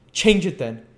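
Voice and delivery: mocking laugh. A narrator's reading. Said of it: "responded the girl with a laugh".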